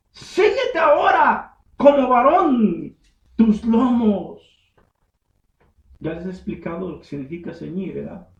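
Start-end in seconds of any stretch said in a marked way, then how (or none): 0.1-4.4 slow, higher, solemn divine command; voice of God. Acted. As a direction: command slowly from the whirlwind. Voicing God to Job.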